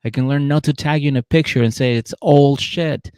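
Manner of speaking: high, loud hammed-up plea; mock pleading boyfriend. Derek pleading with Monique at the rink, hammed-up. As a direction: beg her back, proud and pleading. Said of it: "he's like".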